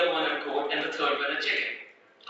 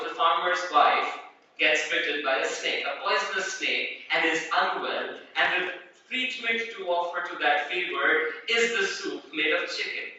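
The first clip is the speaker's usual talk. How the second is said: ordinary talk, nothing marked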